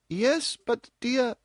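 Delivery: high, slow, hesitant mother; mother's voice. A narrator's reading. Mother begins questioning her daughter, slow and hesitant. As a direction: start the question then falter.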